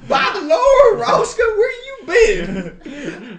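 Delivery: high, loud, slow laughing Southern mom; hammed-up Southern mom. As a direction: wail it like a scandalized Baptist mother. Hammed-up Southern mom scolding Roscoe.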